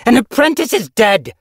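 high, loud outraged cry; outraged snarl. A narrator's reading. Yellowfang confronting leader about the death, outraged. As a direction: hurl it at him in fury. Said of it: "Yellowfang snarled".

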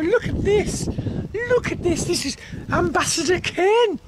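usual speaking voice

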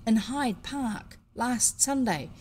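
plain reading voice

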